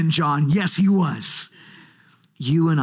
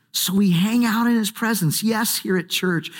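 usual speaking voice

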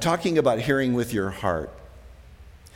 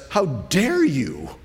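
slow, offended how dare you; indignant retort; 4 semitones higher, much slower than usual. Acted. Sinful heart of man rebuking God, slow indignant. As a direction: bristle like a proud sinner. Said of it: "sinful heart of man says".

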